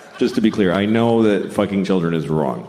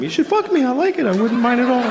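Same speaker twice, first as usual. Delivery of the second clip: high squeaky kid voice, mocking; high child voice; 13 semitones higher than usual. Kids begging the comic, in a high squeaky voice. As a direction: pitch way up like a little kid.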